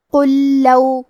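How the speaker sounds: قل لو with the two ل merged into one doubled ل; tajweed demo. Shown as a demonstration. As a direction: join قل لو into a single stressed ل. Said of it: "ایک ہی لام اور تشدید کے ساتھ پڑھیں گے". (قل لو، دونوں لام ملا کر تشدید کے ساتھ)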